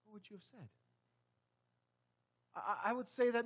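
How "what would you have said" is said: very soft, almost whispered; preacher asking the congregation, barely audible; hushed question. Real, not acted. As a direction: drop to near silence and ask. Echoed question dropped to hush.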